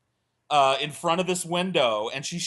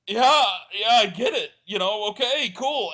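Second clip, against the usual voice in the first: high nervous agreement; 6 semitones higher, 4 dB louder than usual. Retold or imitated. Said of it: "And I was like".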